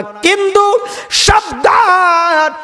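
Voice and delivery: high, drawn-out cry of warning. Acted. Mother cow warns the master who drinks her milk, warning cry. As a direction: stretch the warning out high and slow.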